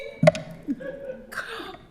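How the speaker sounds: breathy high laugh; high airy laugh. Retold or imitated. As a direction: let out a giddy, airy laugh. Sherry laughs after thanks.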